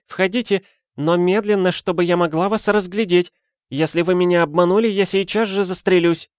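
high, dismissive woman's voice; an exasperated lady waving off his logic; lady's voice. Acted. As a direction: brush him off in a high affected tone. Lady sets conditions.

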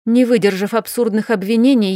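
usual speaking voice

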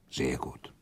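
soft near-whisper, drops right down; hushed approval. Acted. Prince quietly approving the count, hushed near-whisper. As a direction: murmur it half to yourself.

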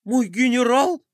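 high, tearful call; sobbing question. A narrator's reading. Melkotron calls after the departed general, sobbing. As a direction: call after him through sobs. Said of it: "всхлипнул Мелкотрон".

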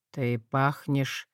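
low and slow, suspicious; lightly voiced wary lord; slow suspicious dragon.

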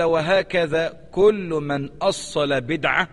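slow, deliberate reading, each word weighed; solemn slow quotation; slow reading of the quote. Shown as a demonstration. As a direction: read it out slowly for the students. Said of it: "انتبه لهذا الكلام".

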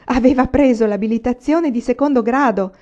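ordinary delivery

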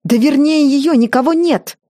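mother-in-law defending her maid; indignant tirade. A narrator's reading. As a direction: rant in shrill outrage. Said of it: "вознегодовала она".

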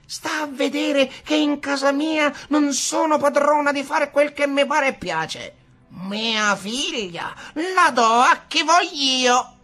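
high, loud indignant screech; screeching rant. Acted. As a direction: rage that she is mistress in her own house. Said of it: "strepitava ancora comare Venera". (strillo furioso di comare Venera)

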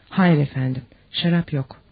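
ordinary delivery, nothing marked

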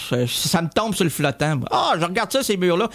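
ordinary delivery

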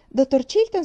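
calling out again. A narrator's reading. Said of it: "felice lo salutò".

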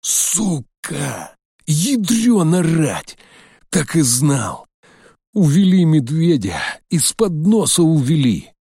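furious swearing, slow on the oath; angry cursing. A narrator's reading. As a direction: spit out the curses in rage. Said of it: "сдавленно выматерился".

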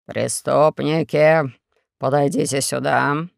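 low, slow imperious order; commanding dictator. Acted. Four-Eyes summoning the prisoners, low slow command. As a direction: summon the prisoners slowly from the throne. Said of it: "приказал Четырёхглазый".

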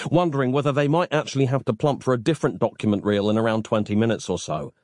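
plain reading voice

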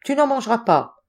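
lightly acted flat-out refusal; stubborn refusal. A narrator's reading. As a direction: forbid it in a squabble. Opponent forbids in mock quarrel.

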